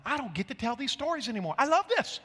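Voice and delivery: high, rising excited exclamation; real delight. Real, not acted. Preacher to congregation, loving these stories, real delight.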